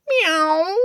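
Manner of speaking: high, thin mewing cat; a high, complaining meow. Acted. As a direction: mew like a discontented cat stuck up high. Cat crying from the curtains.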